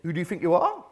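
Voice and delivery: mock London heckler; London accent. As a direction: challenge them in a London accent. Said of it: "do it in a London voice".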